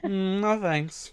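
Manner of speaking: slow, drawn-out dry refusal; mock refusal. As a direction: stretch it out, unimpressed.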